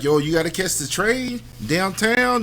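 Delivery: high, slow mock local voice; imitating New Yorkers. New Yorkers giving him directions, high drawn-out imitation. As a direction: stretch it out, pitched well up. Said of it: "And they're like".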